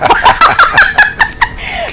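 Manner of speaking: high-pitched burst of laughter; real laughter. Real, not acted.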